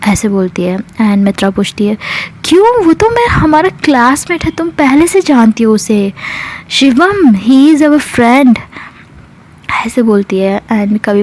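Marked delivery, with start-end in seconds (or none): 2.4-8.6 high-pitched indignant questioning, easing down; acted protest. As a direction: protest as if baffled by the accusation. (हैरानी भरा विरोध)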